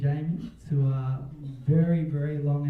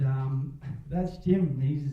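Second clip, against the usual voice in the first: ordinary talk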